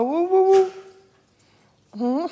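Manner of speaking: high, loud, slow drawn-out woos; excited hooting. Real, not acted. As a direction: hoot with delight at the dogs. Excited at the dogs.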